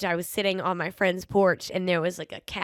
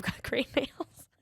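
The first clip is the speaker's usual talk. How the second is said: laughing; 6 semitones higher, 8 dB quieter, much slower than usual. Real, not acted. Joking about cat attitude.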